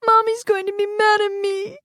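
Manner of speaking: put-on very high small boy voice; upset child. Little boy fearing his mother, upset. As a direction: fret like a scared toddler.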